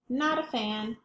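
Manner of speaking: ordinary delivery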